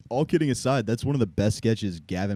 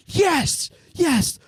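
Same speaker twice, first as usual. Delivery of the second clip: much higher, slow joyful cry; mock elation; 4 dB louder, slower than usual. Man at the meeting celebrating the answer, a high joyful cry. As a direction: cheer like a sudden miracle.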